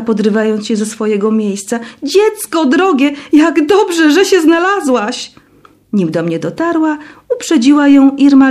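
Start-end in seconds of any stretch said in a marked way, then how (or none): 2.0-5.3 relieved joy. A narrator's reading. Relieved headmistress greets lost girl.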